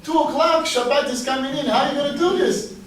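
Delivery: high, loud and urgent, voice climbing; alarmed exclamation. Retold or imitated. Son on the phone telling father he drove wrong, alarmed and loud. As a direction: sound the alarm at the wrong turn.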